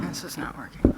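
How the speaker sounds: muttered whisper; whispered aside. Real, not acted. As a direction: whisper it under your breath. Frustrated aside during a call.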